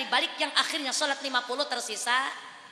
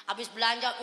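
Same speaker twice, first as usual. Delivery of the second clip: ordinary talk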